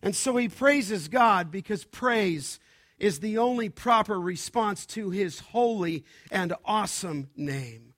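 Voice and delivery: usual speaking voice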